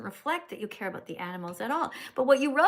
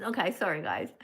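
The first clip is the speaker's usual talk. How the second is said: ordinary talk, nothing marked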